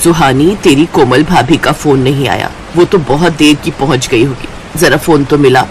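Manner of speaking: put-on low older-woman voice; worried mother-in-law. Mother-in-law asks daughter to phone, low and concerned.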